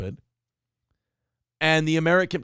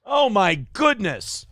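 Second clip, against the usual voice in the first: high, drawn-out scandalized gasping words; loud whoa, then slow prissy dismay; mock shock; 5 semitones higher, 5 dB louder, much slower than usual. Host feigns horror at naughty words, mock shock.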